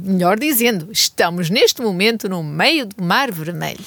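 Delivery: ordinary talk, nothing marked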